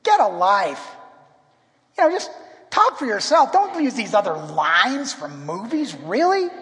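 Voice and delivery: exasperated. Retold or imitated. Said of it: "I remember consciously thinking".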